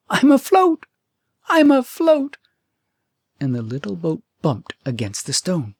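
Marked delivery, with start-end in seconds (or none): 0.1-2.4 high, loud, delighted cry; excited cry. A narrator's reading. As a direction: shout it out in sheer delight.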